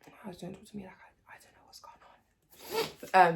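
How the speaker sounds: near-whispered, slow mutter; hushed re-enacted brush-off; retold muttered reply. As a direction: mumble it under your breath, barely voiced. Retold sulky reply in car.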